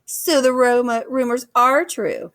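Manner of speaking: slow, high taunting laugh in the words; mocking laugh. A narrator's reading. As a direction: laugh at them, drawn out. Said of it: "Nettle laughed".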